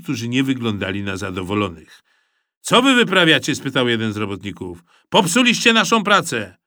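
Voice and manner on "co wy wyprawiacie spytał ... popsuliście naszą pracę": loud indignant complaint; annoyed worker. A narrator's reading. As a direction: protest the ruined work.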